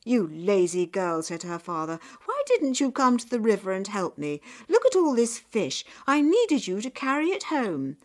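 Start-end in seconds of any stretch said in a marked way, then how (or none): 0.0-1.2 loud, scolding accusation; cross raised-voice rebuke; angry father. A narrator's reading. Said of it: "He was angry". 2.2-8.0 loud, high scolding; heated, raised-voice telling-off; angry father. A narrator's reading. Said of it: "He was angry".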